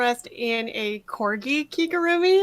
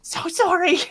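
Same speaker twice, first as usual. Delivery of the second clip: simpering apology; 5 semitones higher than usual. Acted. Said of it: "you play a simp very well".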